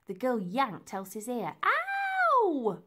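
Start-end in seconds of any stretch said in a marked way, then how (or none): 1.6-2.8 loud, very high drawn-out ow; high wailing cry of pain; pained yelp. Acted. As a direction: yelp as if your ear is yanked. Elsie cries out in pain.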